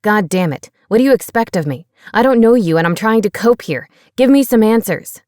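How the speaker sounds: building to a loud, fast rant; yelled. Acted. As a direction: explode at the silent computer. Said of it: "Pete yelled".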